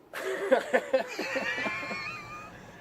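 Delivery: very high giggle; high-pitched giggle; high squealing laugh. Real, not acted. Laughs at own guess.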